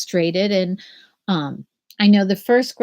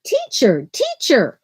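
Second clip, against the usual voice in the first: put-on reading to children; slower than usual. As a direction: read it aloud like story time for first graders. Reads the picture book aloud.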